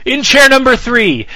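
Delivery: big booming game-show intro; announcer voice. Acted. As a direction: boom it out like a ring announcer. Host's booming cohost introduction.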